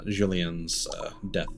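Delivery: ordinary talk, nothing marked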